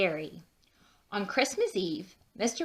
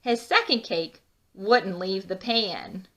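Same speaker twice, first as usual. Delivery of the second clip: ordinary delivery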